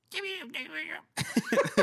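high squeaky chirping laugh; ant noise. Pretend. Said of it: "give me your best Ant impression".

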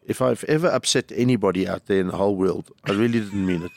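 ordinary delivery

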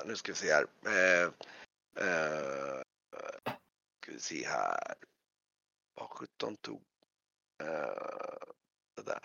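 low, hushed orc muttering to himself; very low creature voice searching; deep orc voice. Acted. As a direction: rumble it low like a rummaging orc.

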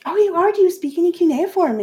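mock surprise; surprised. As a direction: caught off guard by the dead man. Hasno surprised by undead.

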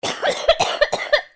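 strained, painful-sounding cough; loud hacking cough. Real, not acted. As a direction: cough hard from the chest. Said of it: "That was just my coughing".

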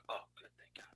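barely audible whisper; hushed, relieved whisper; whispered relief. Retold or imitated. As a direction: breathe out thanks under your breath. Relief at dog going inside.

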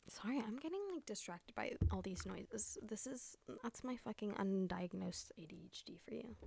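unvoiced self-mocking aside; whispered aside. Real, not acted. Vlogger whispering about her undiagnosed ADHD, quiet. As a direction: whisper it, half to yourself.

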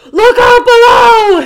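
loud, high, slow thunderous shout; thundering voice. Acted. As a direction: let it boom down the chimney. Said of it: "thundered the voice".

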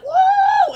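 very high, loud whoop; imitated group cheer. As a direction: whoop like a cheering crowd on a call. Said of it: "everybody on the FaceTime was like".

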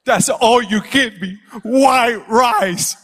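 high, loud, drawn-out complaint; imitated crying son. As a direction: wail it like a wronged little boy. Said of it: "he's crying and crying and crying".

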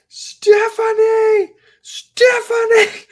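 drawn-out high shout of a name; panicked scream. Retold or imitated. Terrified of a snake, calling her sister in a long high shout. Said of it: "And I scream".

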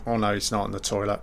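usual speaking voice

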